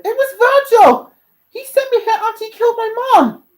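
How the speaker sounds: high, loud outburst; distressed accusation. Acted. Dante telling Stolas who killed his mom, upset and loud. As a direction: blurt it out loud at the top of the voice.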